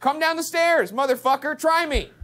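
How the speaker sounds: high-pitched bravado; defiant taunt. Real, not acted. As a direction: dare an unseen enemy to approach. Daring the ghost.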